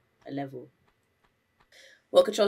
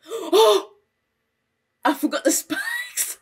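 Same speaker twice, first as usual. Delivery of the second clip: shocked yelp; 7 dB louder, slower than usual. Real, not acted. Shocked by spikes.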